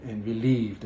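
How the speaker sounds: normal talking voice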